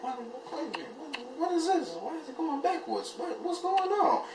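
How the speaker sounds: high, soft, half-laughing alarm; panicked squeak. Retold or imitated. A nervous flyer as the plane rolls backwards, high panicky squeak.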